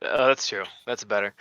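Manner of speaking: ordinary delivery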